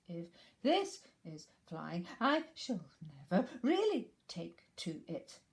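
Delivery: slow, jerky frightened little voice; bumped about in a pocket; Piglet, jolted. Acted.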